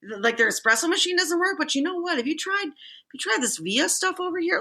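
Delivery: friendly stranger. Retold or imitated. Stranger calming angry customer in Starbucks, upbeat. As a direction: win him over with upbeat sympathy. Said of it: "He's like".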